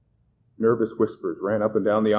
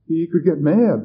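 fearful; 7 semitones higher, 7 dB louder than usual. Acted. Terrified man fears box.

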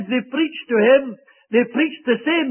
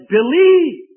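loud; 4 semitones higher, 6 dB louder than usual. Acted. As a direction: proclaim "Believe." loud. Sermon climax on the answer.

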